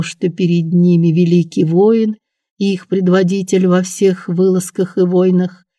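usual speaking voice

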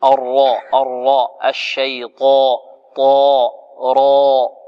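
teacher drills الراء and طاء, heavy and drawn out; tajweed letter drill; letter names drilled. Shown as a demonstration. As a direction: spell out الراء and طاء emphatically. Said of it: "ركز على الطاء".

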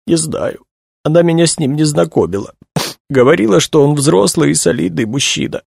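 high, sniffly crying voice; tearful. A narrator's reading. Weeping young woman answers questions about her friend, tearful. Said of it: "всхлипнула она".

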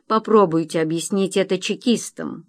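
ordinary delivery, nothing marked

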